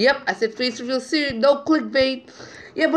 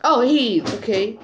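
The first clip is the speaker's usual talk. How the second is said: ordinary delivery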